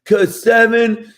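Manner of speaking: put-on eager child answering in class; kid voice. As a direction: play a small kid blurting an answer. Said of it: "They're like".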